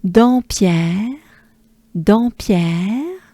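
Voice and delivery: Dampierre with nasal first vowel, rising end; French pronunciation. Shown as a demonstration. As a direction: model French "Dampierre" for learners. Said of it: "Let's take a good breath".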